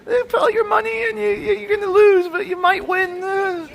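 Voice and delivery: mocking a gambler mesmerized by shiny machines. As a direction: shriek like a dazzled simpleton at a slot machine. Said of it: "mesmerized by the risk and by the shiny".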